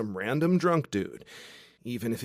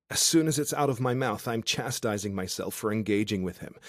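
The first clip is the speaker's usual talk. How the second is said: ordinary delivery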